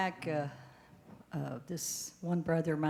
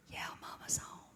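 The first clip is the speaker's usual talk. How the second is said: whisper; 9 dB quieter than usual. Retold or imitated. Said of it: "he whispered when he answered".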